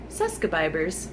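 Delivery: quoting a friend's muddled "Suskabibers"; friend's mispronunciation. Retold or imitated. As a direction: say "Suskabibers" for subscribers, dropped low. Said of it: "as my friend calls it".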